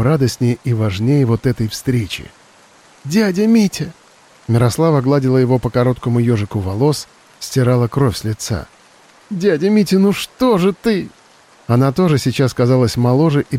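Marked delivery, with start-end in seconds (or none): 3.0-3.9 tearful cry. Acted. Girl cries to dying uncle. 9.3-11.1 tearful plea. Acted. Girl weeps over dying uncle.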